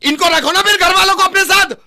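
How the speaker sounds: high, loud, relentless yelling; furious shouting. Acted. Husband yelling at the stranger over his wife, furious. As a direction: tear into him for taking sides. Staged fight, escalating shouting.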